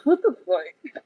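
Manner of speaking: can't hold the laugh in any longer; disbelieving laugh at a tarot card; laughing through it. Real, not acted. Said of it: "I'm trying not to laugh".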